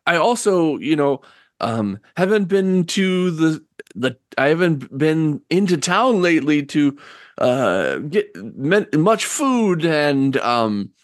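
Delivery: halting, embarrassed request; sheepish old woman. Acted. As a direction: hesitate with ums and restarts while asking. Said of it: "like, very sheepishly".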